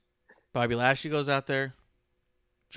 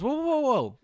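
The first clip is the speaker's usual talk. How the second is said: mock alarmed whoa; 13 semitones higher, 5 dB louder than usual. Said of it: "And he goes".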